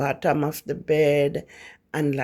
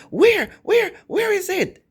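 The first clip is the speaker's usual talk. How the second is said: much higher and louder, hunting for it; urgent grandfather; 5 dB louder than usual. Retold or imitated. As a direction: demand where the spirit is, machete in hand. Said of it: "And he's like".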